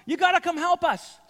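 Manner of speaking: high, loud shout across water; calling for help. Acted. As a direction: yell urgently to partners in another boat. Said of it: "they signaled to their partners in the other boat".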